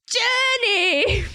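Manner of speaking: put-on high sung-out old woman yell; old lady screaming. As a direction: wail the word like a crone calling after someone. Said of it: "She's, like, screaming".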